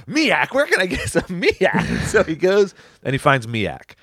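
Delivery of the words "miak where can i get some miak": high, drawn-out clueless question; Ernest. Acted. Ernest asks where to find miak, high and eagerly clueless. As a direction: play an eager dim hero, pitched up and slow. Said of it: "but he was like".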